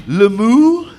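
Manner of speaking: mock French cow moo; French-accented cow impression; George the cow. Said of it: "impression of George the cow".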